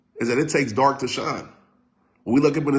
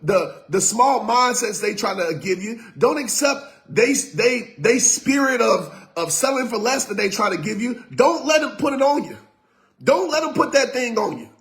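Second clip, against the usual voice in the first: full-force preaching; 10 semitones higher than usual. Acted. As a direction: preach it to the crowd, all in. Preaching climax to live viewers.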